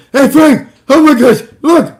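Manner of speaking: squealing excited buddy voice; all excited. Retold or imitated. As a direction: imitate a friend yelping with excitement. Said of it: "he's all excited".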